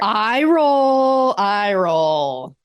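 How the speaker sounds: drawn-out, exaggerated eye roll; mock eye roll. Co-host answering a huge eye-roll moment, exasperated mock drawl. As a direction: stretch it out, starting high then sinking. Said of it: "huge eye roll right here".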